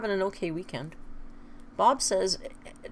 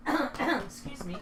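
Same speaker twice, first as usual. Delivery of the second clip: ordinary delivery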